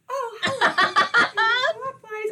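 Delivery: drawn-out, prim greeting; prim mock greeting. Mocking the friend's video opening as a prim, drawn-out greeting. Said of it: "It was".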